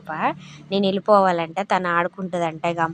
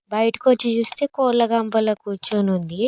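normal talking voice

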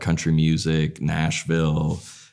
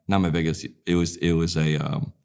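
normal talking voice

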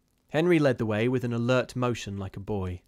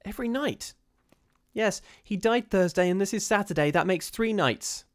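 frightened; 10 semitones higher, 3 dB louder than usual. Acted. Terrified sisters.

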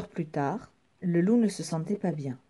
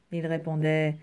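ordinary delivery, nothing marked

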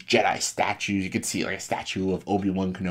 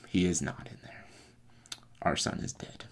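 soft, downcast acted reply; subdued grief; 9 dB quieter than usual. Han answering Leia about their lost son, subdued. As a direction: let the words sink away quietly.

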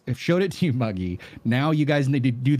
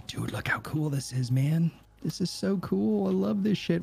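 awed hush; 5 semitones higher, 5 dB quieter than usual. Real, not acted. Amazed by new base tool.

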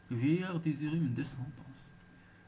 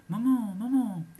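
calling out; 8 semitones higher, 9 dB louder, slower than usual. A narrator's reading. Children alarm their mother.

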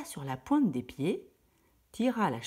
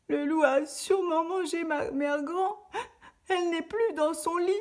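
mild crying; 13 semitones higher than usual. Acted. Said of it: "elle se mit à pleurer".

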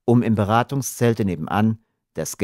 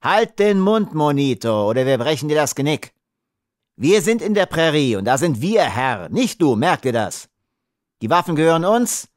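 raised-pitch, bullying command; angry threat; 6 semitones higher than usual. Acted. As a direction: put the captive firmly in his place. Smuggler threatens and silences the captive.

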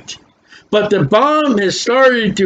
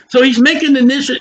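ordinary talk

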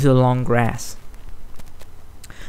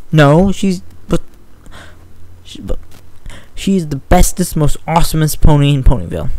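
indignant bickering; 3 semitones higher, 3 dB louder than usual. Acted. Scootaloo defending Rainbow Dash to Sweetie, indignant. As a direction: defend her hero hotly.

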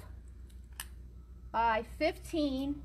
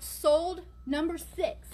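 each word slow and separate; slow, spelled-out; 4 semitones higher, much slower than usual. Shown as a demonstration. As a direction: spell it out slowly for viewers. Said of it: "so you would write".

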